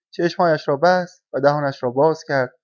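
plain reading voice

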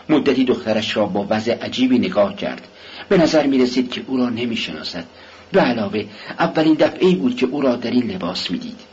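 plain reading voice

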